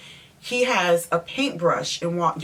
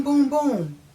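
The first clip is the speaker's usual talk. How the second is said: high, booming knock sound; imitated knocking; 7 semitones higher than usual. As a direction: mimic knocks on a window, pitch jumping up. Imitates knocking on car window.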